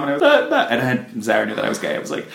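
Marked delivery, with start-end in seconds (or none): 0.2-0.7 put-on pleading teen. Pleading to friend.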